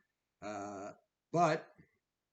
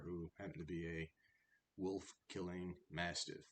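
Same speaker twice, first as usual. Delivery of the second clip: ordinary delivery, nothing marked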